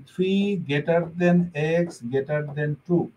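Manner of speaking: very slow, word by word; slow demo reading. Shown as a demonstration. Teacher reads the symbols aloud for students, very slowly. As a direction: lay each word out slowly. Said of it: "এইটাই যদি আপনি এভাবে লেখেন".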